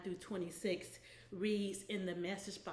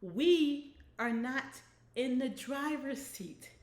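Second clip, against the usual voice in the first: louder, slow and spaced out; emphatic repeat; 3 semitones higher, 5 dB louder, slower than usual. Real, not acted. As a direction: drive it home for the hard of hearing. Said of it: "repeat that for those who may be hard of hearing".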